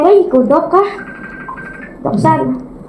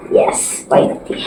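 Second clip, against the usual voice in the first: whispered relief; 5 dB quieter, slower than usual. Real, not acted. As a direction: breathe it in a whisper.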